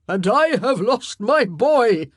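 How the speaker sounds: high, loud, slow wail. A narrator's reading. King wails over his lost son. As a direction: wail out the loss. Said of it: "Henry wailed".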